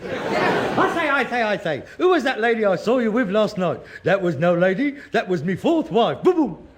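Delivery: put-on quick-fire old variety comic patter; music-hall double act. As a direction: do a corny comedian's gag routine. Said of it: "a double act".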